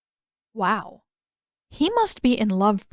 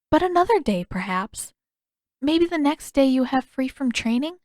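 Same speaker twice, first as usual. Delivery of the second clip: a lightly voiced, higher young princess, gently hopeful; Yue's voice; 6 semitones higher than usual. Yue agreeing to ride the sky bison, lightly voiced and higher.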